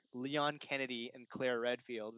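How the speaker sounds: ordinary delivery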